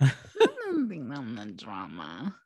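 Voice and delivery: hammed-up mock-innocent voice; meme impression. Answers the drama meme in voice.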